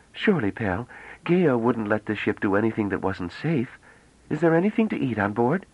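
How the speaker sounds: put-on high, bright-eyed girlish voice, full of wonder; young woman Bliss, eager. A narrator's reading. Young woman marvelling at the ship, high and excited.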